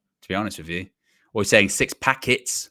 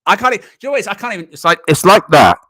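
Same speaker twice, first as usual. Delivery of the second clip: high, loud, crackling broken-microphone voice; crackly bad mic impression; 10 semitones higher, 12 dB louder, faster than usual. Shown as a demonstration. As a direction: mimic a cheap mic full of crackle. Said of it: "with a crackle in there as well".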